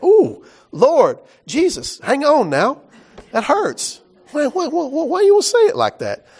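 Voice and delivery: high-pitched, hammed-up hurt listener; mock wounded protest. Preacher playing listeners stung by Jesus' words, mock wounded. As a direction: plead in a high put-on voice, as if stung. Voices congregation stung by the teaching.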